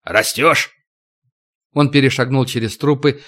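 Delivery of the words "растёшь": bandit boss's approving remark; grinning ataman. A narrator's reading. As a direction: play a chieftain pleased with his henchman. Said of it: "оскалился Макото".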